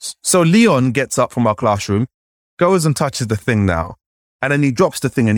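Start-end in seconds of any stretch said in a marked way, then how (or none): none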